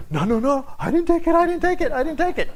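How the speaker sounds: high, pleading denial repeated; hammed-up panicky protest, pitched high; frantic Benjamin. Benjamin denying the theft to his brothers, high and frantic. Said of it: "what's Benjamin thinking".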